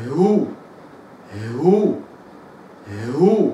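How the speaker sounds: هو from a still h into u; damma hu. Shown as a demonstration. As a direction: drill هو, rounding after the h. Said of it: "قول معي يلا". (هو مضمومة من السكون)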